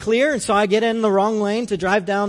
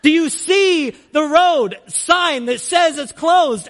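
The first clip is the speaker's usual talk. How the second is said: higher, slow scolding; mock angry worker; 7 semitones higher, 3 dB louder, slower than usual. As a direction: scold the driver slowly, pointedly. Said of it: "were just like".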